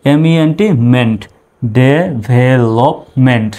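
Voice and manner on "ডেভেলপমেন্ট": "Develop" stretched out syllable by syllable; very slow model word. Shown as a demonstration. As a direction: drag the word out for learners. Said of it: "এভাবে ভেঙে ভেঙে উচ্চারণ করবেন". (খুব ধীরে টেনে "develop")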